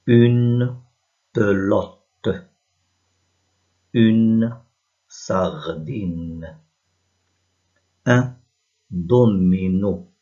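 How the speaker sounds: loud, isolated syllables and words with long pauses; slow reading model. Shown as a demonstration. Teacher reads syllables and words for pupils to follow, slow and deliberate. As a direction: articulate each one slowly and separately for learners.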